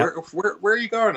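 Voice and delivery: hammed-up worried passenger; imitating passenger. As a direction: squeal it like a nervous passenger. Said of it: "that's always a conversation in my car".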